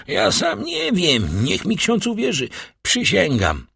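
high, whining protest of innocence; desperate pleading. A narrator's reading. Cornered burglar swears he knows nothing.

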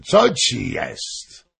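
slow, drawn-out question, falling; king's slow, insistent inquiry; asking. A narrator's reading. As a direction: stretch the question out with emphasis. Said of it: "zapytał król".

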